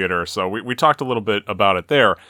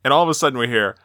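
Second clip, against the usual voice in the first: ordinary delivery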